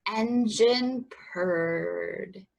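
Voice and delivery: ordinary delivery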